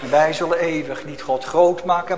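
ordinary delivery

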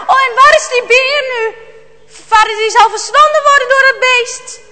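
high, loud alarmed voice; panicked. Acted. Jarno fears father will be devoured, high panic. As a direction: blurt it out in dread for someone.

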